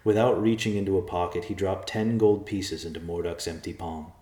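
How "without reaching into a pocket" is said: plain reading voice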